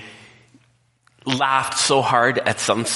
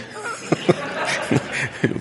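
laugh; 4 semitones higher than usual. Real, not acted. Laughing at own joke.

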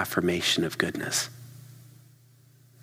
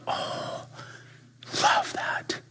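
breathy, hushed savoring; soft murmured delight; savoring sigh; 4 dB quieter, much slower than usual. Pretend. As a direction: breathe it like the first sip. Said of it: "You just went".

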